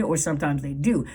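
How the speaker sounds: ordinary delivery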